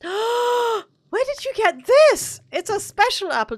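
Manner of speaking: gasp, high and loud, slow wonder; amazed gasp at a rare gift; surprised gasp. Real, not acted. Surprised by gifted apple.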